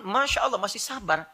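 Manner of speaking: ordinary talk, nothing marked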